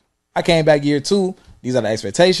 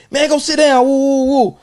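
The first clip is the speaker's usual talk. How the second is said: much higher, louder teacher shout; imitated yell; 12 semitones higher, 5 dB louder than usual. A teacher yelling at a kid to sit down, high loud shout. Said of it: "if I yell at the kid".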